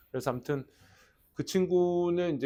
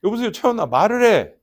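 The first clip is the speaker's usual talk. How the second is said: louder, pleading "말을 해"; urgent call; 5 semitones higher, 8 dB louder than usual. Retold or imitated. Teacher urging a silent student on the phone, loud and urgent. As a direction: urgently press the kid to speak.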